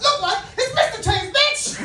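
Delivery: high, loud, cocky retort; mock-indignant boast. Correcting fans who say he resembles a rapper, high and mock-indignant. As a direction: act affronted and crow it. Acted retort to people comparing him.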